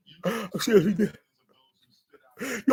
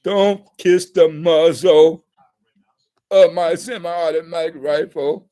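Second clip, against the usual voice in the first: slow begging; 6 semitones lower, slower than usual. Retold or imitated. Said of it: "I beg him, I say".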